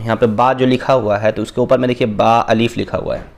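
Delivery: ordinary talk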